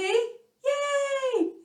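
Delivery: high happy yay; showing a happy voice; happy cheer. Shown as a demonstration. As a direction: cheer it gleefully.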